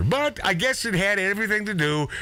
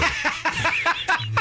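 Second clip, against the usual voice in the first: a high burst of laughter; 12 semitones higher than usual. Real, not acted. Laughing high at himself while telling friends about the second drink. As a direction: laugh high at your own bad idea. Laughs at his own folly.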